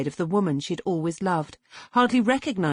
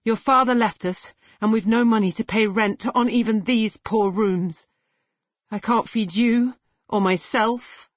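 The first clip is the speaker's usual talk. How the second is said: louder, higher, desperate mother; despairing mother; 5 semitones higher, 4 dB louder than usual. A narrator's reading. Mother explains to Cassie why they must die. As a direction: plead in despair over poverty.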